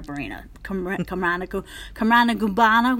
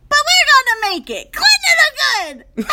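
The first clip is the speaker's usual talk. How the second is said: high, loud blurted proclamation; frantic stage cry; 7 dB louder, faster than usual. Retold or imitated. Imitating castmate blurting her botched line, frantic cry. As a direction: burst out of the silence, high and loud. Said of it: "freaking out".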